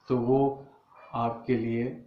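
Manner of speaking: usual speaking voice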